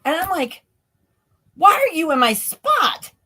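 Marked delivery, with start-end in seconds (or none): 1.5-3.1 indignant retold outburst. Retold confrontation with intruder in dream.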